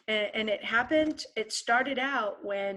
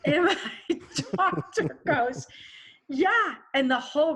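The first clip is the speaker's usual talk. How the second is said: real laugh breaking through, higher and louder; laughing while telling; 7 semitones higher, 6 dB louder than usual. Real, not acted. Telling the host about surgery, laughing. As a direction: laugh out the words.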